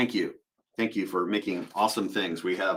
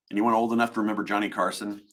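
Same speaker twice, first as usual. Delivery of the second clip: ordinary talk, nothing marked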